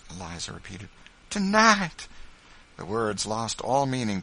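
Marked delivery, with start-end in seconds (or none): 1.3-2.1 very high, loud exclamation; high-pitched, astonished cry; overwhelmed amazement. A narrator's reading. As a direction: cry it out, overcome by the news. Eliza repeats it again.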